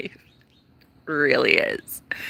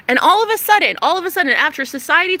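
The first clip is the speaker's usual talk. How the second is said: much higher and louder, exclaiming; excited outburst; 8 semitones higher, 5 dB louder, faster than usual. Real, not acted. Vlogger announces the twist in her story, excited outburst. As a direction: burst out with the news.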